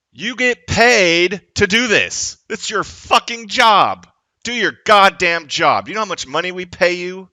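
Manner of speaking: loud, high, slow angry yelling; furious shout. Real, not acted. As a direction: hammer each word at the cops. Angry rant at police.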